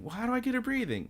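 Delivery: very high, fast mock panic; panicked boy. Boy asking how to revive the girl, high frantic voice. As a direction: rush it out high, like a frantic kid.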